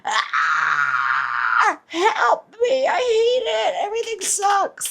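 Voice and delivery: high, shrill-pitched mock cry for help; mock screaming. Imitating a panicking body mid-run, high crying shout. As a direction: wail it like everything's falling apart. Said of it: "as everything is screaming".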